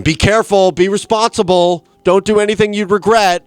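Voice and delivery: fussy worried parent. Retold or imitated. Boy warning the older kids to be careful, shrill like a parent. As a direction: play the eleven-year-old lecturing like a parent. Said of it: "I'm going".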